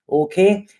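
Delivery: normal talking voice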